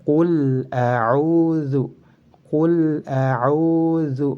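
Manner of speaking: slow, drawn-out "qul a'udzu" with l fully sounded; chant-like recitation, crisp l in "qul", long u in "a'udzu"; clear lam. Shown as a demonstration. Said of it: "harus dibaca jelas".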